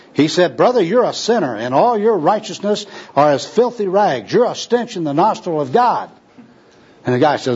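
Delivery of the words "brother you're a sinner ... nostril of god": high-pitched evangelistic accusation; zealous street preaching. Acted. Gospel worker condemns a tramp as sinner, zealously. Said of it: "with obvious enthusiasm".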